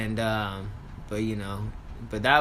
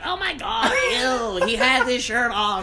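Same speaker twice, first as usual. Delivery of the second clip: put-on much higher bratty kid voice; little kids' voice; 6 semitones higher than usual. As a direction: squeal it like disgusted kids. Little kids mocking him.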